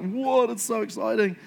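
high, thrilled reader's reaction; playful amazement. Acted. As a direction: act amazed, pitch jumping up. Acted reader thrilled by healings.

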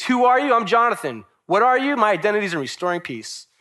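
high, loud self-questioning, answered with conviction; emphatic call and answer. Acted. Preacher voicing a believer's questions and answers, emphatic.

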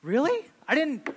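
high mock-shocked bride; mock surprise. Wife teasing husband's vow, high mock surprise. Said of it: "She's like".

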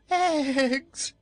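very high, loud scream of terror; horrified scream. Acted. Nome King told of eggs, screaming in horror. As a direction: shriek the word in horror. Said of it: "screamed the Nome King in horror".

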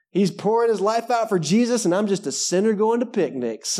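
high, put-on whiny self-reproach; hammed-up poor-me voice; mock self-pity. Voicing a self-pitying listener.

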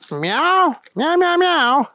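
high, running string of meows; meowing kitty. Acted. Thunder Kitty answering the police chief, meowing. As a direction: chatter away in cat meows. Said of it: "said Thunder Kitty".

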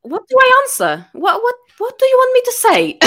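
high, strained what do I answer; high exasperated plea; exasperated disbelief. Retold or imitated. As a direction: sound completely at a loss. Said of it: "this time I was like".

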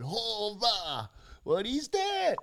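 high-pitched, drawn-out cry of amazement; startled cry. Retold or imitated. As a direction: call out high, astonished at something overhead. Quoting startled beachgoers staring at lights.

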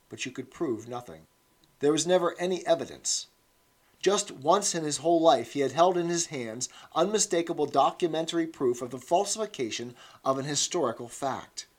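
usual speaking voice

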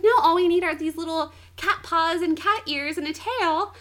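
high, loud, gushing fangirl; nerding out. Retold or imitated. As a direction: imitate someone eagerly geeking out. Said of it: "completely nerding out".

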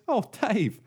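high, happy mock greeting; acted pleasant surprise; delighted recognition. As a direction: welcome an old friend fondly. Attenborough recognises his friend.

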